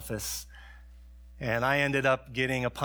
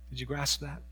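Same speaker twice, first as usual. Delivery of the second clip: ordinary delivery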